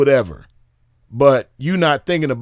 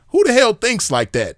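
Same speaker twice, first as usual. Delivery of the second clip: who even thinks that, up high; incredulous; 8 semitones higher than usual. Real, not acted. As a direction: pitch jumps up in disbelief. Mocking disbelief at the idea.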